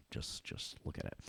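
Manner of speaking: hushed, breathless whisper; whispered aside. Acted. Teacher whispering to students over the trick, hushed aside. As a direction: whisper it like a secret.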